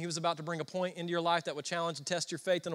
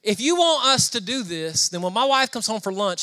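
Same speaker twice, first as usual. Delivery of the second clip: higher, louder mock bargaining with God; loud pleading; 4 semitones higher, 6 dB louder than usual. Preacher retelling his demand to God, loud pleading.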